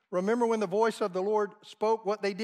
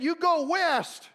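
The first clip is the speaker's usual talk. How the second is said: higher, louder, slow command; defiant rebuke; 8 semitones higher, 4 dB louder, slower than usual. Retold or imitated. Woman rebuking Satan's voice in her head, a bold higher louder command. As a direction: order the devil off firmly. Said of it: "She said".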